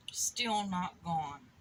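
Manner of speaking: slow, drawn-out disappointment; slow letdown. Acted. Piglet telling the ducks the hiccups remain, deflated. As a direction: stretch it out, deflated.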